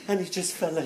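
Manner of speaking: ordinary delivery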